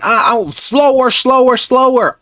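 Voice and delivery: slow, high nagging nurse voice; mocking the nurse. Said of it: "the nurse kept on saying".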